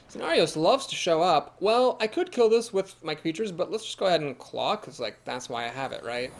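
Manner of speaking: ordinary talk, nothing marked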